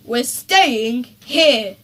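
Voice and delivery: father overruling both sons, firm; Dad firm. A narrator's reading. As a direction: lay down the law slowly. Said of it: "said Dad".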